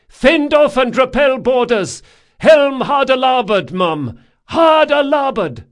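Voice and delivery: high, loud command; crying out. A narrator's reading. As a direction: bark the order to the whole crew. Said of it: "cried Drakasha".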